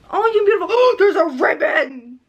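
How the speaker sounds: loud, delighted gasp of discovery; gasping surprise. Real, not acted. Spotting a ribbon in the gifted book, gasping surprise. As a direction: break off with a gasp and exclaim. Spots a detail on gift.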